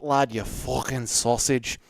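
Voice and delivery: put-on a light take on a Scouse accent; Paddy Pimblett. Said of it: "And then with Paddy Pimblett".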